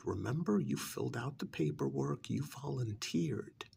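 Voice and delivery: ordinary delivery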